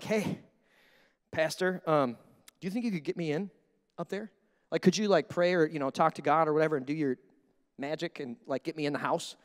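soft, low, hesitant asking; timid request. Acted. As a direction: sheepishly ask for a favour on the quiet.

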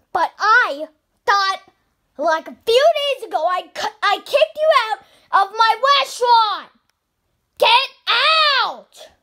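high, loud furious yelling; angry owner shouting at a banned customer. Acted. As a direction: roar him out of the restaurant.

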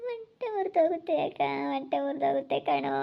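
soft, broken sobbing lament. Acted. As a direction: weep quietly, heartbroken. Mother sobbing over lost daughter. (ಮೃದುವಾಗಿ ಬಿಕ್ಕಳಿಸುತ್ತಾ)

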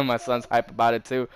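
ordinary talk, nothing marked

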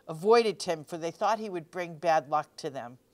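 ordinary delivery

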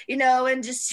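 usual speaking voice

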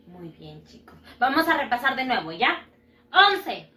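ordinary talk, nothing marked

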